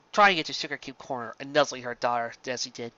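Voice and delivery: usual speaking voice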